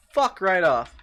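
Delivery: high loud exasperated "Fuck right off"; frustrated loud curse. Real, not acted. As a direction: shout it at the monster. Escaping the enemy.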